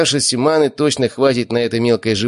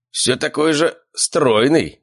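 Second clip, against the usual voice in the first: slow, high, with a pause for the word; playful, searching for the last word; teasing; 7 semitones higher, much slower than usual. A narrator's reading. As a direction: draw it out as a jovial uncle's jibe. Uncle teases nephew, picking word.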